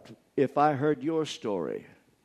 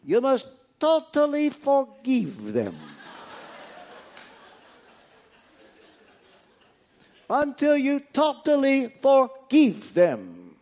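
put-on slow, higher and louder, in a Romanian accent; 4 semitones higher, 4 dB louder, slower than usual. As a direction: quote the old friend slowly and firmly in his Romanian accent. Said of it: "I can hear him now in his Romanian accent".

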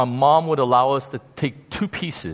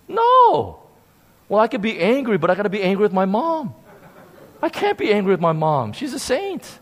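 ordinary talk, nothing marked

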